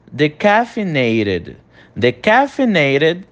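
decaffeinated drawn out; slow English pronunciation. Shown as a demonstration. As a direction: model decaffeinated for repetition. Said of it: "você vai repetir a pronúncia". (decaffeinated devagar em inglês)

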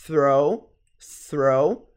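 "throw" repeated with rising OH glide; contrasting OH vowel. Shown as a demonstration. Teacher setting throw against through, rising OH. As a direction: model "throw" with TH and OH, lifting at the end. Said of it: "ao invés de".

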